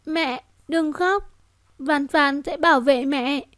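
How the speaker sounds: put-on high, slow child speaking gently; a small child soothing her mother; little girl voice. Daughter reassuring her crying mother, high slow child voice.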